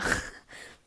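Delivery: breathy snorting laugh; a gross little laugh. Real, not acted. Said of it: "That was a gross, uh, laugh".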